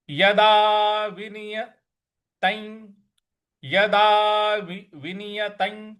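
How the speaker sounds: यदा विनियतं drawn out slowly, syllable by syllable; slow Sanskrit recitation. Shown as a demonstration. Teacher showing students slow chanting. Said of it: "इस प्रकार से".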